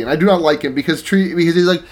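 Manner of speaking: usual speaking voice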